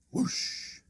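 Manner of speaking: breathy whoosh effect; airy whoosh sound; whispered whoosh. Acted. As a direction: blow the whoosh out like wind.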